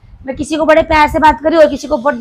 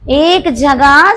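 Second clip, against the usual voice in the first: very slow and a bit louder; slow dictation; 5 dB louder, much slower than usual. Shown as a demonstration. Teacher translating the principle for students, slow. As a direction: stretch each word for the class. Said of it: "teacher dictating in Hindi".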